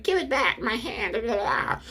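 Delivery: very high, laughing whiny plea; mocking whine. Devil begs for its severed hand back, in a high mocking whine. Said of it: "the devil's like".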